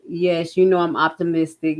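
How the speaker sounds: normal talking voice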